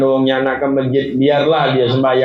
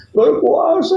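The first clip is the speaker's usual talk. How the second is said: high, wailing cry on the word; sobbing answer; slower than usual. Acted. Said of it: "Dijawab sambil nangis, gerung-gerung". (menjawab sambil menangis meraung)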